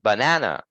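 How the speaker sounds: banana with a firm middle, ba-NA-na; banana, short first and last syllables, stressed middle; force on second syllable. Shown as a demonstration. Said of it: "some force on it".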